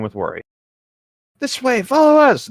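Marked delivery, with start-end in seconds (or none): 1.4-2.5 very high, loud squeaky fairy shout; yelled fairy call. Acted. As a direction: call them along like a tiny fairy. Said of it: "Navi yelled".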